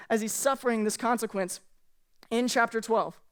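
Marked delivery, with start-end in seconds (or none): none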